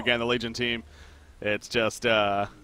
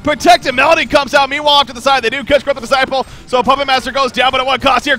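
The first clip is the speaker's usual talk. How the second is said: loud, high, hyped-up casting; excited play-by-play; 9 semitones higher, 8 dB louder than usual. Real, not acted. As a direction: shout the teamfight call. Big teamfight play-by-play.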